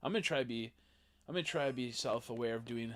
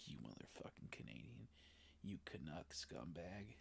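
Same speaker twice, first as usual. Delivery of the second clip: mock muttered insult; 6 semitones lower, 16 dB quieter than usual. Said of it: "I'm like".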